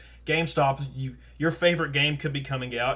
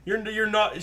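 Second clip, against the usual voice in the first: higher-pitched mock disbelief; incredulous comeback; 7 semitones higher, 3 dB louder than usual. Worker answering an elderly assistant manager at Walmart, incredulous. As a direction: splutter at the absurd rank-pulling.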